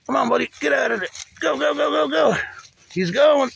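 loud, high cheering as he breaks free; excited cheering. Real, not acted. To the stuck turtle, cheering him loose, loud and excited. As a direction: urge him on with a shout.

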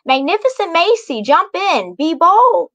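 high, loud call to jump in; screamed. A narrator's reading. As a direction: shout it like a brave command. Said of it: "as she screamed".

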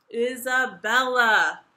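huge, drawn-out groan of the name; booming, stretched exasperation; loud groan. A narrator's reading. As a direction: groan it in royal despair. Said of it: "he groaned".

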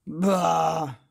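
loud frustrated "Bah!"; shouting. Acted. Marvin alone in the dark, shouting in frustration. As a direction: bellow it into the dark. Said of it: "shouted Marvin".

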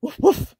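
loud, high imitated bark; two punchy mock barks; dog's woof. A narrator's reading. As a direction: woof like a storybook dog. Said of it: "said dog".

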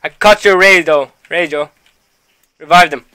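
urgent yell; shouting. Real, not acted. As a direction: bark the orders loud. Yelling orders during chaos.

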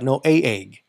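ordinary talk

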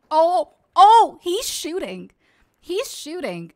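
loud, stretched startled cries; real alarm. Real, not acted. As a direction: cry out as shots start. Gunfire starts.

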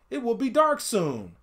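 put-on fretful kid brother; youngest brother warning. A narrator's reading. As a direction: warn that night is coming. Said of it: "the youngest said".

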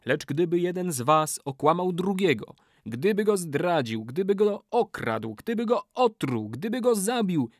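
high-pitched, mounting incantation; calling out a curse. A narrator's reading. Old man warns the brothers against betrayal, incanting. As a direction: build the list of crimes, rising in pitch. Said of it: "zawołał stary murzyn".

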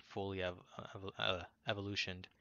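tripping over "evolutioned"; stuttering. Real, not acted. Gamer commenting on a battle, stuttering on a long word. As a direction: stammer the first syllable, then push the word out. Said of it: "I'm stuttering on that word".

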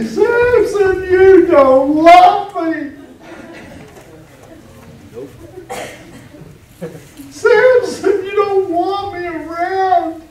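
high, pleading whine at Samson; whiny nagging. Acted. Wife whining to Samson for his secret, high nagging plea. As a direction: whine like a wife begging for love. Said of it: "she nagged at him".

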